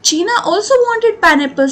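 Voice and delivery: slow, deliberate reading aloud for pupils; slow story reading. A narrator's reading. Teacher reading the story line to students, slow and clear. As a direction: read each word out clearly and slowly.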